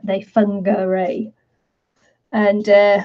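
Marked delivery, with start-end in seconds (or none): 0.2-1.3 "Whangarei" opening with an f, ending in ay; Maori pronunciation. Shown as a demonstration. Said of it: "That's how the Maoris pronounced it".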